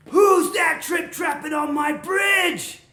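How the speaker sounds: loud, high, drawn-out bellow; troll yelling. Acted. Troll jumping out at middle goat, yelling. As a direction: roar slowly, stretching the last word.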